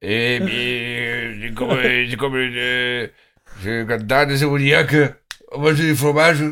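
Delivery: put-on halting rustic Cantal accent. Cantal local warning a cheese thief, halting mock Cantal accent. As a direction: play a menacing cheese farmer from Aurillac. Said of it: "ils parlent vraiment comme ça".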